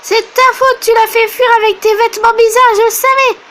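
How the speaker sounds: put-on high, loud accusing child's voice; angry child. Mary blaming Daisy for scaring her pet. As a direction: throw the blame at her in a childish rage.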